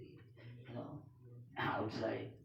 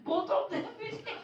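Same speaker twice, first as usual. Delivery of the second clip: real helpless laughter, higher; laughing; 12 semitones higher than usual. Real, not acted. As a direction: tell it while you can't stop laughing. Laughing through the story.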